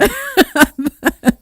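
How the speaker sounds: squealing laugh; high laughter burst. Real, not acted. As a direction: crack up high and loud.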